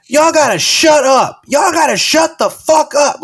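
high, slow yelled order to shut up; mock host yelling. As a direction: bark it at the room like a fed-up host. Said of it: "she just goes".

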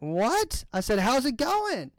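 high-pitched puzzled retelling; baffled repeat. Retold or imitated. Retold repeat after baffling reply.

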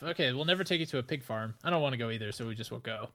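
normal talking voice

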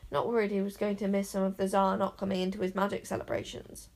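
ordinary delivery

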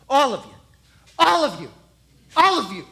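loud, repeated taunt; shouting David. Acted. David shouts at the soldiers, calling them cowards. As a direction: yell it again and again at the cowards.

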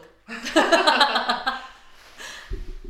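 loud burst of laughter. Real, not acted. Laughs at own joke.

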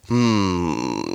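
low-pitched doctor voice. Retold or imitated. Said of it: "diperiksa sama dokternya".

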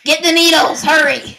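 loud, panicked yell for help; urgent shout. Acted. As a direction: shout it like there's an emergency. Urgent alarm in the sketch.

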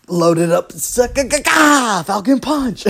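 high, hammed-up Falcon Punch shout; over-the-top fighting game battle cry; mock Falcon Punch. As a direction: wind up and yell it like a video game character. Hammed-up Falcon Punch sound effect.

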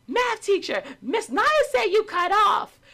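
high, loud, gleeful schoolgirl call; girls tattling. Retold or imitated. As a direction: call out to the teacher like a giggling kid spilling a secret. Girls loudly tattle to teacher.

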